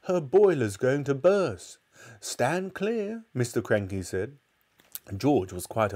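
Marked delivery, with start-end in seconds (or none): 0.0-1.8 loud, drawn-out alarmed wail. A narrator's reading. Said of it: "Missus Cranky wailed". 2.2-3.2 high, stretched call; warning call. A narrator's reading. Calling out a warning.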